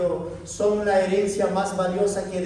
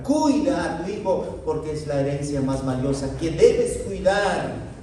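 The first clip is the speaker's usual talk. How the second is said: ordinary delivery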